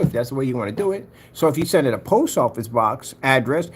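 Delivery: usual speaking voice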